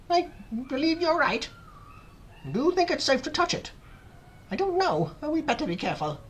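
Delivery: high, hesitant voice, not shouted; cautious wonder. A narrator's reading. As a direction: ask and answer warily in a high voice. Aunts hesitate after a pause.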